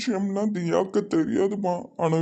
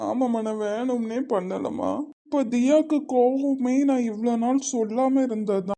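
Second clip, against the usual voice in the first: higher-pitched crying explanation; tearful; 3 semitones higher than usual. Acted. Son telling mother it was unintended, tearful and higher. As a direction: explain yourself through tears. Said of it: "ஏல, அழாத".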